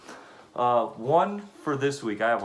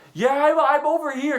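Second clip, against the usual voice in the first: mock loud driver on the phone; 12 semitones higher, 6 dB louder than usual. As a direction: boom it into the phone. Driver voiced on phone call.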